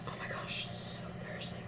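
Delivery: breathless embarrassed whisper. Real, not acted. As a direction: whisper it, cringing. Cringing, whispered reaction to video.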